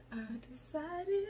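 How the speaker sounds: unvoiced stage whisper. She repeats her resolution to viewers in a whisper. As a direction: lean in and whisper it like a secret.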